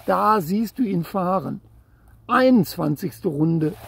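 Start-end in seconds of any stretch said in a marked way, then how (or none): none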